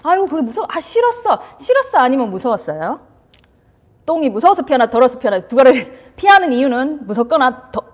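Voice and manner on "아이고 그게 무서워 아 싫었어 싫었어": put-on high, sing-song sympathy to a child; high soothing baby talk; sympathetic high coo. Shown as a demonstration. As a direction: coo it up high like comforting a toddler. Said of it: "그래서 첫 번째 뭐 공감".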